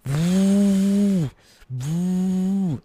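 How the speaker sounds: loud, high droning vroom like a roaring console fan; jet engine vroom. Retold or imitated. Describing his loud console to the co-host, a high loud engine drone. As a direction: imitate a PS4 fan going full blast. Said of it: "that's what it sounds like".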